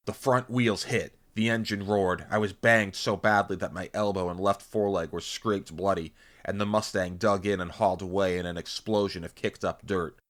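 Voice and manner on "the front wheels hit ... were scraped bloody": ordinary delivery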